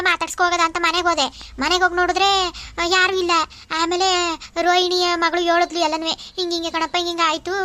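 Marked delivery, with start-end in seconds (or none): none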